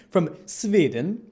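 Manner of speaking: "From Sweden" slow, with Sweden said as "Svidən"; Swedish accent. Shown as a demonstration. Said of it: "I can't say Swe-, Sweden in an accent without doing the Swedish accent".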